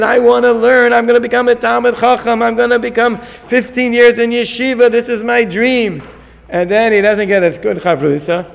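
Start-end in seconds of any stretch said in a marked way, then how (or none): 0.0-6.0 high, eager dreaming voice; excited yeshiva boy. Pretend. New yeshiva student announcing his dream, high and excited. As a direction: gush about your big plans. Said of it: "he's all so excited".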